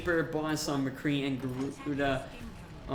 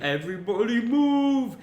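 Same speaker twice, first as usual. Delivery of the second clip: booming, higher movie-giant shout; Andre the Giant; 7 semitones higher, 7 dB louder than usual. Retold or imitated. Caster quoting Princess Bride's giant, booming shout. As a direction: bellow it like a giant clearing the way. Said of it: "think of Andre the Giant".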